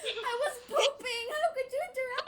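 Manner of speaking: high, loud, whiny outburst; hammed-up indignant squeal; mock outraged shriek. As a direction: wail as if caught in private. Mock outrage at being caught.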